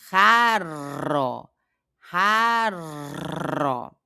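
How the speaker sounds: raro with a rolled first R and a tap in the middle; slow, careful raro; trilled R. Shown as a demonstration. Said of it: "Ra, outra vez".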